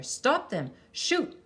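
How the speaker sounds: loud, high barked order; captain ordering archers to shoot at the girls; captain's shout. A narrator's reading. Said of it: "the captain shouted".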